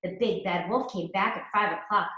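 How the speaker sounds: ordinary delivery, nothing marked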